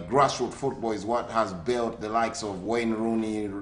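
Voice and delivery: usual speaking voice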